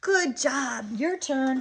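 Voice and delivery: put-on bright-eyed toddler praise; sing-song praise. Shown as a demonstration. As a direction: cheer a small child on in sing-song. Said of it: "and I'm sing-songing".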